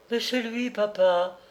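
high-pitched, plaintive wail; moaning lament. Acted. Estelle recognising her cousin's portrait, plaintive moan. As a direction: whine it to your father. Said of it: "gémit Estelle".

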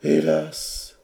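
high, woeful exclamation; sighing lament. A narrator's reading. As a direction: sigh it out theatrically. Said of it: "versa le punch en soupirant". (soupir plaintif, haut perché)

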